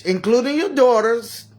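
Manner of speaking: ordinary talk, nothing marked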